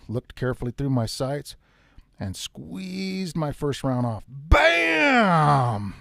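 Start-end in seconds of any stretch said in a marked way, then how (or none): 4.3-5.9 loud, higher shouted bam like a rifle going off; a shouted bang, mimicking the rifle; imitated gunshot. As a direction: belt out the gunshot noise. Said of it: "that son of a bitch was loud".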